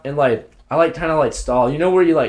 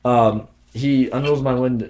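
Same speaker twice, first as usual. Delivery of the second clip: usual speaking voice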